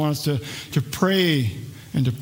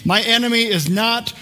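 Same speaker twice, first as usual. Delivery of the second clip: ordinary talk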